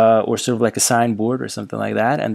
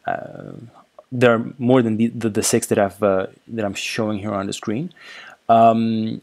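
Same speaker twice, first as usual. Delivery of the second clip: ordinary talk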